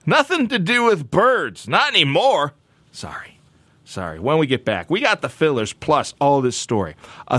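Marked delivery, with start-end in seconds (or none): 0.1-2.5 high whiny put-off complaint, slowing down; disgusted listener. Retold or imitated. Listener at dinner refusing chicken, high whiny voice. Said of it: "You're like". 2.9-4.2 soft breathy apology; whispered sorry. Real, not acted. Host apologizing quietly to listeners, whispered.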